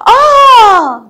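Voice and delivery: loud, high, sustained "aah" yell; demonstrated shout. Shown as a demonstration. Teacher demonstrating the opposite of whispering, with a long yell. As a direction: let out a long high cry. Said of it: "ده صاحة".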